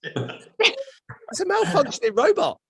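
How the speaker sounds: very high, drawn-out exclamation; slow, high-pitched comic outcry; incredulous squeal. Retold or imitated. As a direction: squeal the punchline in mock disbelief. Acted disbelieving punchline about the cashier.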